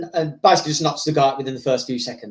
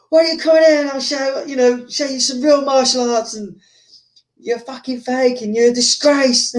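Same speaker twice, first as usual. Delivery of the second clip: retold taunt; 7 semitones higher than usual. Retold heckler challenges film star.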